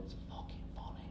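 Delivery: barely voiced whisper; secret inner thought. Brain tempting him during a solemn story, whispered. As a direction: whisper it like a thought that mustn't escape. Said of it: "in my brain, I'm going".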